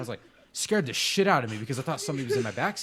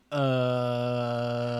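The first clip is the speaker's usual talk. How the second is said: [name]. Retold or imitated. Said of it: "it's [name] going".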